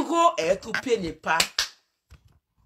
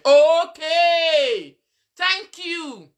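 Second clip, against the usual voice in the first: loud sarcastic okay; 6 semitones higher, 8 dB louder, much slower than usual. Real, not acted. Exaggerated reaction.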